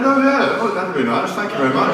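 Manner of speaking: high-pitched, mock-casual yes; reply in a high voice, settling back down; high, lightly comic acceptance; acted eager acceptance. Acting out his reply to the offer.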